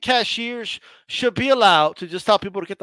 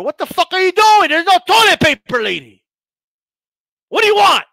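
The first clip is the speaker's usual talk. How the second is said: higher and louder, furious shopper ranting; mock yelling customer; 7 semitones higher, 5 dB louder than usual. A customer screaming at a Walmart employee, mock furious. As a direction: blast it at the worker. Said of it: "you're just yelling at somebody".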